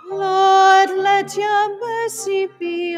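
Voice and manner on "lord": usual speaking voice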